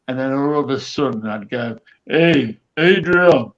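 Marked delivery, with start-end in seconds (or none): 2.1-3.5 loud call across the ward; calling the male nurse from bed. Retold or imitated. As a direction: shout the name out into the night. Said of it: "I do it still on me now. It sounds a bit rough".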